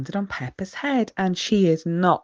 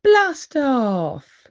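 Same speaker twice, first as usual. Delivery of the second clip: loud and higher, a big launch call; booming announcement; 9 semitones higher, 6 dB louder than usual. A narrator's reading. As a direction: boom it out like a rocket countdown. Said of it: "a voice booms".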